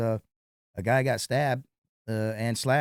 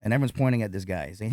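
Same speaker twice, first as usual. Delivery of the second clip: ordinary delivery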